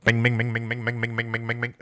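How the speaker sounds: sung-out bing bing imitating the shop noise; nasal bing bing alarm imitation; imitated beeping. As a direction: mimic an annoying beeping alarm. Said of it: "Dude, it's so annoying".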